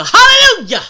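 loud mock church hallelujah; imitated hallelujah shout. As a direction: cry it out like a worshipper, half laughing. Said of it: "he even gave a little".

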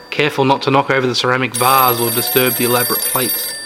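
usual speaking voice

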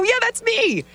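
high, bright yes; excited. Retold or imitated. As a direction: call it out high and eager. Answering at the golf event.

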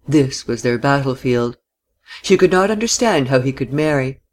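ordinary delivery, nothing marked

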